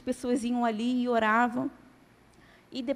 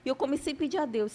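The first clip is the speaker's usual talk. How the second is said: usual speaking voice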